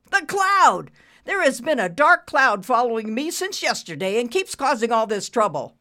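loud, high outburst; frantic ranting. A narrator's reading. Boss to baffled staff about the cloud, frantic ranting. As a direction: rant at full voice.

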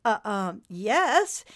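stammer, then answer in surprise; mildly startled agreement; surprised. A narrator's reading. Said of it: "he said in surprise".

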